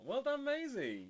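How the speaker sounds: excited praise. Real, not acted. Praising a viewer's drawing.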